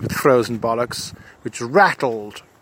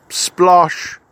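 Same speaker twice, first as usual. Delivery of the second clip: a loud, higher splosh; splosh sound effect; 5 semitones higher, 6 dB louder than usual. Acted. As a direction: make the splash noise with relish. Balloon hits the sprite.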